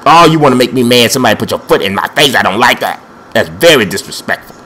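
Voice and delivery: ordinary talk, nothing marked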